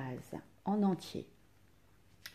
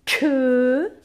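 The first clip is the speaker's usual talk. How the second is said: Que said high and loud; loud dictation of Que; emphatic dictation; 4 semitones higher, 9 dB louder than usual. Shown as a demonstration. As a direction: call out Que firmly. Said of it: "Alors attention".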